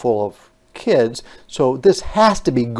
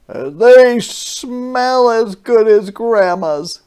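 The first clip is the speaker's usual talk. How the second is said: put-on child's voice; 10 semitones higher, 8 dB louder, slower than usual. Sam praising the cookies.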